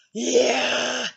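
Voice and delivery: ordinary delivery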